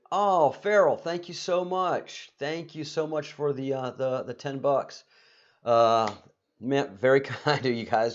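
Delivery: a real burst of delight at the gift; excited thanks. Real, not acted. Host thanks Farrell for the donation, gushing excitedly. Thanking donor for ten bucks.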